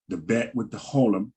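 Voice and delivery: ordinary talk, nothing marked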